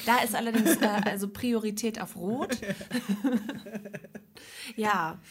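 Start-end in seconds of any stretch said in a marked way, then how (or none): 2.6-4.7 laugh. Real, not acted. Laugh at the traffic-light joke.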